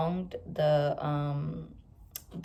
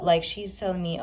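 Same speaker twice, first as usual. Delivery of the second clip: ordinary talk, nothing marked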